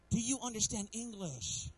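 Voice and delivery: put-on slow, disbelieving parent to a child; exasperated parent. Parent to a child who won't listen, slow and disbelieving. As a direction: ask it slowly, as if the kid can't hear you. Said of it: "you're looking at them like".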